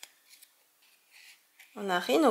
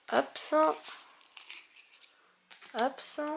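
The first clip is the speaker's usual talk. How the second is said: normal talking voice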